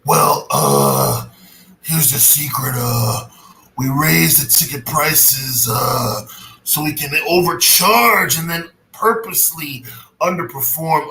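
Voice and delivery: hammed-up wrestling-boss impression, loud and drawn out; mock corporate villain revealing the secret; Triple H. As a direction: boom it out slowly with heavy dragged-out uhs. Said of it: "Triple H voice".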